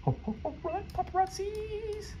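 high, soft mock alarm; high mock panic. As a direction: squeal it like fleeing paparazzi. Falsetto panic joke.

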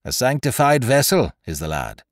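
a touch of pious sermonizing; lightly voiced sanctimonious gunner; preaching manner. A narrator's reading. Gunner defending the boy with mock piety, lightly preachy. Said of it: "in his best preaching manner".

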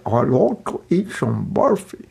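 slow, careful put-on Scottish accent. Scottish devotee repeating himself slowly, Scottish accent. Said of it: "difficult to understand Scottish accent".

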